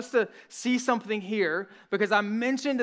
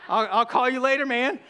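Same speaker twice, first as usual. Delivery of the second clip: flustered laughing brush-off; 4 semitones higher, 4 dB louder than usual. Retold or imitated. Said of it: "And here's what I did".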